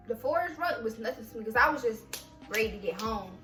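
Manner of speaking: ordinary delivery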